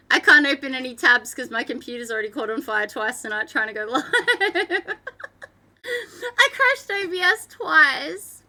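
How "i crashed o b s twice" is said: high, slow, helpless laughing wail; laughing despair. Real, not acted. Streamer owning up to crashing the software, high laughing wail. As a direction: confess it high, through the tail of a laugh. Laughing over own tech disasters.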